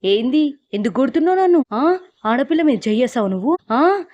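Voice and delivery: possessed wife screaming at her husband for the slap; angry shouting. Retold or imitated. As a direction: retell the possessed fury, shouting him down. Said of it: "గట్టి గట్టిగా అరుస్తూ".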